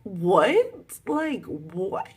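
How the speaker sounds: loud, high, rising "what"; incredulous. Real, not acted. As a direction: blurt it in baffled disbelief. Baffled by betrayal.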